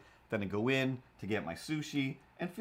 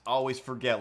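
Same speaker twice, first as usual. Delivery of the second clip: usual speaking voice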